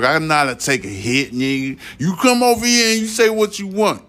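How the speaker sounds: fired-up callout to his critics; heated challenge. Real, not acted. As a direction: pitch shoots way up, daring them to come. Daring critics to confront him.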